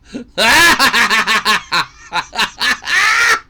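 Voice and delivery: loud, high burst of laughter. Real, not acted. Laughs at the GTA news.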